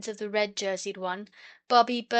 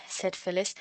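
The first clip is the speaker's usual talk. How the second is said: ordinary delivery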